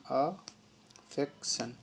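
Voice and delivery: Affection stretched out very slowly, syllable by syllable; teacher drags out affection so students catch each sound; slow drawn-out demo. Shown as a demonstration. Teacher modeling affection for students, very slow and drawn out. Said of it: "दोनों तरीके से पढ़ रहे हैं".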